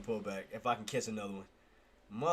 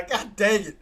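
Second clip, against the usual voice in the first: ordinary talk, nothing marked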